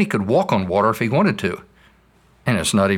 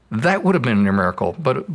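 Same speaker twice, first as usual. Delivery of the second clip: ordinary talk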